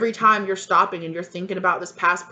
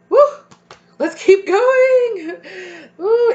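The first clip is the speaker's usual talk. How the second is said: excited whoop; 11 dB louder, much slower than usual. Real, not acted. Shaking off tears, restarting reading.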